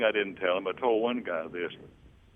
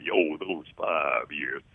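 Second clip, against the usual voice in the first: low, slow bass singer; deep bass voice; 6 semitones lower, much slower than usual. Retold or imitated. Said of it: "He said".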